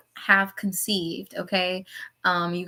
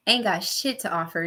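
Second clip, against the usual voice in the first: normal talking voice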